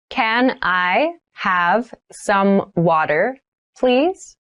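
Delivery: slow, careful request for learners; slow model read. Shown as a demonstration. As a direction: ask slowly so students can copy. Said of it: "teacher models sentence, third take".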